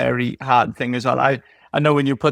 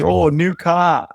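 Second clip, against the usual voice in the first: drawn-out, higher mimicked "oh"; mock admiring remark; 4 semitones higher, slower than usual. Owner imitating people who notice the car, mocking. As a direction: stretch it out, imitating nosy onlookers.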